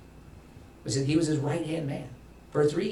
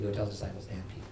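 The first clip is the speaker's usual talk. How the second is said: quick soft recap; 3 semitones lower, 10 dB quieter, faster than usual. Shown as a demonstration.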